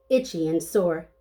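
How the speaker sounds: plain reading voice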